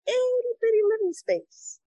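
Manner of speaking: lightly voiced cramped Genie; a hint of the Genie complaining. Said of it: "Genie from Aladdin".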